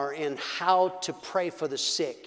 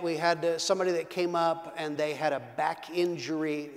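usual speaking voice

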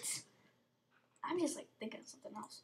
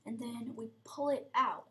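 ordinary delivery

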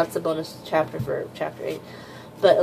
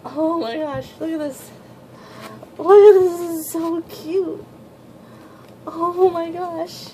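much higher cooing voice, dragged out at times; gushing squeal; 10 semitones higher than usual. Real, not acted. Fan cooing at Yuki, Machi and little Mitsuki, high coo. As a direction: coo over the pictures with delight. Gushing over cute pages.

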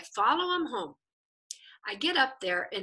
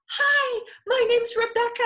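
much higher and louder bright hello; eager greeting; 12 semitones higher, 7 dB louder than usual. Retold or imitated. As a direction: reach out to him with eager delight. Eager greeting, hands held out.

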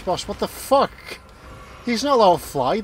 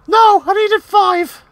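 loud goofy high voice; 14 semitones higher, 10 dB louder than usual. Pretend. Player squeals in a put-on voice. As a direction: belt it out high and silly.

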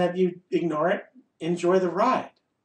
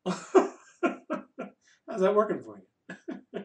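laughing sarcasm; 2 semitones higher, 4 dB quieter, much slower than usual. Real, not acted.

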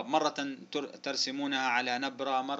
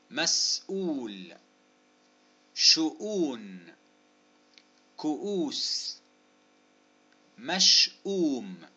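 each word split at the hamza, long "oo"; deliberate, spaced word list; slow dictation; 4 dB louder, much slower than usual. Shown as a demonstration. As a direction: draw out each example word very slowly. Dictating example words slowly.

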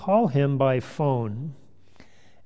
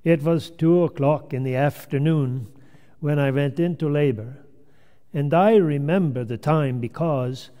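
put-on grandmother telling her tale in a Finnish English accent. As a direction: spin out the story with a Finnish accent. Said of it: "in her Finnish English accent".

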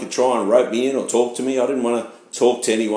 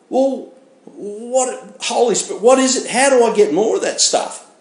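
much higher, breathless eager asking; eager questions; 10 semitones higher than usual. Retold or imitated. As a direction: mimic yourself hungry to know how to get more. Eagerly asking about the Holy Spirit.

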